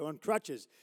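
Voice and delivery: ordinary talk, nothing marked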